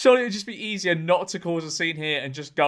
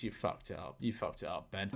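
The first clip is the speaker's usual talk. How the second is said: normal talking voice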